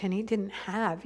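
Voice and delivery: ordinary delivery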